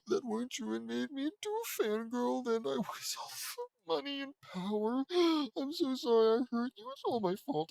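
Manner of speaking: put-on high, falsetto girly voice with laughs breaking through; squeaky hammed-up apology; Sakura confessing. Sakura tells Naruto her mother used her, in a high squeaky voice.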